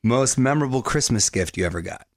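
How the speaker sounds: ordinary talk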